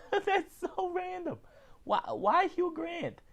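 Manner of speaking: high, giggling disbelief; laughing disbelief. Real, not acted. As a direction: laugh it out in amused shock. Laughs at Hugh Grant news.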